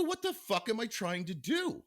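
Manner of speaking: slow, incredulous protest to the doctor; retold indignation.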